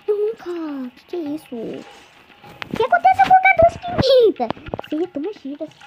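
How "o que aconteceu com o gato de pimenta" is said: much higher squeaky cartoon panic; panicked burnt character. Girl voicing her game character after eating pepper, squeaky high panic. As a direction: squeal it like a cartoon kid who ate pepper.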